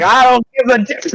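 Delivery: high-pitched angry yell; defiant outburst. Acted. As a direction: throw it back high and heated. Acted quarrel, struggling son.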